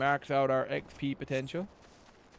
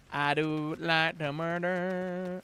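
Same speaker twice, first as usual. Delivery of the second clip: slow creepy villain drawl; 3 semitones higher, much slower than usual. Acted. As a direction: savor every word, creepy.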